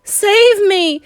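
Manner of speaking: very high, loud plea; desperate cry. A narrator's reading. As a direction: cry out to be saved. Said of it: "cried the wretched witch".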